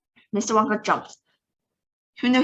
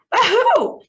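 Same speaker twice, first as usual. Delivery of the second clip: very high, loud demanding yell; 7 dB louder than usual. Acted. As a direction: bark the question in alarm. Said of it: "yelled the president".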